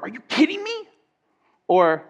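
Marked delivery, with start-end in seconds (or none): 0.0-0.9 high, shocked "Are you kidding me?" with the pitch up on me; shocked disbelief. Shown as a demonstration. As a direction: squeal it in shock. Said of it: "say it a lot of different ways".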